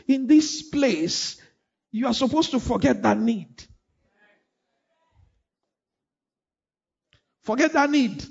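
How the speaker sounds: loud, high fervent preaching; preaching at full force. Acted. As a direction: preach it out to the whole church.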